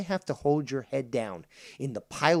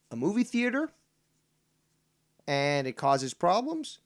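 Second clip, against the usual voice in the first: ordinary talk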